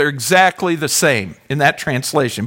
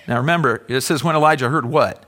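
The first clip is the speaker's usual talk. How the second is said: ordinary talk, nothing marked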